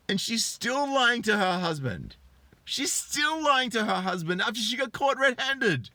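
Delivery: high, stretched-out outraged disbelief; indignant rant. Real, not acted. Commentator condemns a wife still lying to her husband, indignant.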